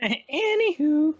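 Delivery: high, slow squeaky anywho; silly high voice. Pretend. Echoing the silly word.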